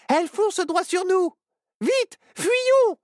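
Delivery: very high, loud cry to flee; panicked shout. Acted. Child yells at friends to flee the spider, panicked shout. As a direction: yell at the others to run.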